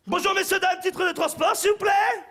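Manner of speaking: high loud gotcha announcement; spring out and bark it; triumphant inspector shout. Acted. Inspectors jump out.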